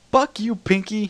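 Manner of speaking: very high, loud furious yell; screaming. A narrator's reading. Luna alone in the ruins, screaming at Pinkie. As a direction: scream it at the top of your lungs. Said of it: "at the top of her lungs".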